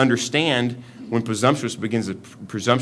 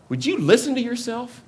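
incredulous rebuke; 14 semitones higher than usual. Retold or imitated. Preacher voicing James scolding the boastful, sharply higher incredulity. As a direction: pitch jumps way up in disbelief. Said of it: "Or it's kinda sarcastic".

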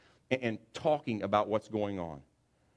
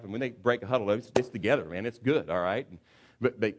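ordinary delivery

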